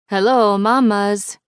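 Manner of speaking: put-on loud, high Italian-accented phone greeting; thick Italian accent. A narrator's reading. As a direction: answer the restaurant phone with a big Italian accent. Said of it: "A thick Italian accent bleeds through the line".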